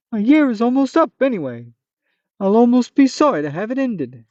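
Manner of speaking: a hammed-up high monster; Manticore voice. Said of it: "this funny Manticore voice".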